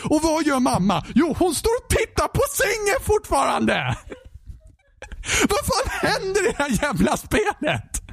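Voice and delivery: half-laughing, half-crying frustration; exasperated outburst. Real, not acted. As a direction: pitch shoots way up in disbelief. Exasperated rant about the game.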